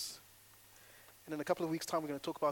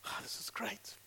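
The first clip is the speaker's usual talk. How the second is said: whispered aside; 5 semitones higher, 8 dB quieter than usual. Real, not acted.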